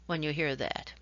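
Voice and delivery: normal talking voice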